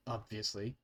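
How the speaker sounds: a dwarf guard muttering, barely audible; muttered. Acted. Dwarf guard mutters to his prisoner, low and soft. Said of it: "he just mutters".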